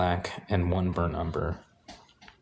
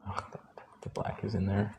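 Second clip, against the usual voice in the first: whispered dismay; 8 dB quieter than usual. Real, not acted.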